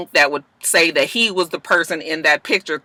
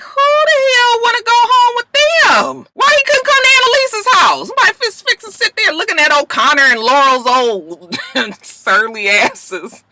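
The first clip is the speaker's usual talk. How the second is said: very high, loud disbelief; shrill incredulous complaint; indignant squeal; 4 dB louder than usual. Real, not acted. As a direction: protest with outraged sass. Reacting to the plot, indignant.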